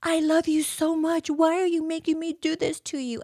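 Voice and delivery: high-pitched mock pleading voice; high imitation of a manipulative partner's plea; pleading abusive ex. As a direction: imitate an ex begging and blaming you. Voicing abusive ex's desperate pleading.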